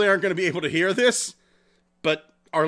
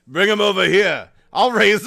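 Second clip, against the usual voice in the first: loud, folksy old-friend impression; boisterous put-on voice, calling out; George; 8 dB louder than usual. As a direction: holler it as a mock local character. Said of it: "And George goes".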